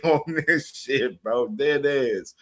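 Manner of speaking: ordinary talk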